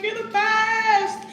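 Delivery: sing-song gush. Retold or imitated. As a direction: sing it out like a fan. Lady asks for Instagram.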